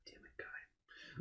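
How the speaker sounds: slow, whispered grumble; imitating a barely audible private curse; muttered under breath. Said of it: "he'd mutter Guy's name under his breath".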